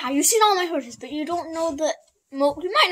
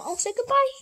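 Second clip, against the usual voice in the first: high pet-talk voice; 11 semitones higher, 4 dB quieter than usual. Real, not acted. Saying goodbye for the horse.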